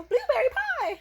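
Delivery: put-on high, loud bratty girl voice; Violet Beauregarde voice. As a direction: squeal it like a spoiled kid. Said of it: "Like, that girl".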